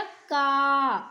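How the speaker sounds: का with the vowel drawn out loudly; clear, strong long vowel. Shown as a demonstration. Teacher shows children k plus the long vowel, loud and drawn out. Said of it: "वो स्पष्ट और ऊंचे स्वर में".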